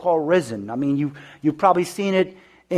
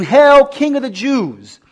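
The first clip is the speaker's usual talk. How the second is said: loud, sneering royal greeting, drawn out at the end; mocking hail; 7 semitones higher, 7 dB louder, much slower than usual. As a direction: hail him like a jeering soldier. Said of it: "They mocked him, saying".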